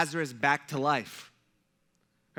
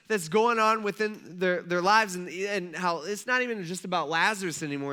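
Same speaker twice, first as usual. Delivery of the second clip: usual speaking voice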